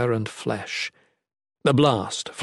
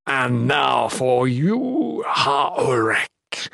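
very slow, stretched villain voice; menacing monster; much slower than usual. Acted. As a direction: relish each word with slow menace. Mickik turns on Ulrich.

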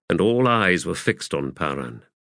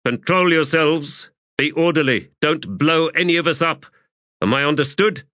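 higher, firm commanding officer; High Fist reining in troops; 8 semitones higher than usual. A narrator's reading. Officer tells jittery sappers to be orderly, firm higher-pitched command. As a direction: insist on order with raised pitch.

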